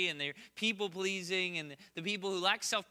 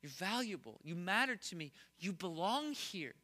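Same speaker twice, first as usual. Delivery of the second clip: softer, tender voicing of Jesus; tender reassurance; 3 semitones lower, 7 dB quieter than usual. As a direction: comfort her quietly. Jesus reassures outcast woman.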